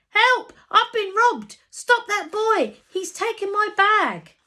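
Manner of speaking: put-on loud, high panicked shout of an old lady; shrill cry for help. Old lady shouting at the crowd to stop the thief. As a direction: scream it like she's just been robbed.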